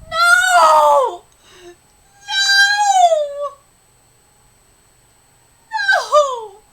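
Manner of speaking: long, high, loud cry of no; dismayed wail. Real, not acted. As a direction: wail it out in despair. Dismayed reaction to the story.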